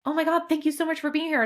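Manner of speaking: high-pitched mock gushing thanks; gushing host. Host thanking the friend she invited, gushing. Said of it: "didn't even make me feel like".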